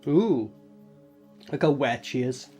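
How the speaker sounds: ordinary talk, nothing marked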